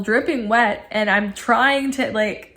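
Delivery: ordinary talk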